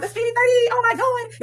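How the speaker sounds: real thrill as she unwraps the bag; excited squeal. Real, not acted. Thrilled unboxing dream bag.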